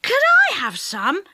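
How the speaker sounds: put-on high, eager boy's plea. A narrator's reading. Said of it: "asked Dick eagerly".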